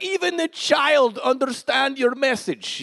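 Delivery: put-on high, slow, terrible Russian accent; [name]. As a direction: imitate an emotional Russian pastor, badly and slowly. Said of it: "this is a terrible Russian accent".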